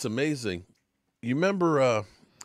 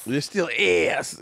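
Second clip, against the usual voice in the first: slow, higher imitation of a fan's accent; stretched mock accent, pitched up; Philly five hundred; 4 semitones higher, much slower than usual. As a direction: echo the fan's accented catchphrase, very drawn out. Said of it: "say it with his accent".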